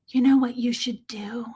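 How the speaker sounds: whispered, leaning in like a secret; mom's whisper. Retold or imitated. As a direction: whisper it like a conspiring mom. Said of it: "she does whisper sometimes".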